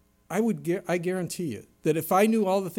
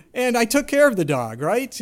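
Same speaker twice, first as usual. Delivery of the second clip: louder, higher laughing complaint; wry exasperation; 7 semitones higher, 9 dB louder than usual. Real, not acted. Dad admitting he cares for the dog, wry. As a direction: deliver the punchline with a rueful laugh.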